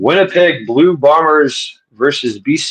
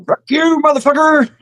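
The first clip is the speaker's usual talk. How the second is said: put-on old man voice. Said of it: "that old man".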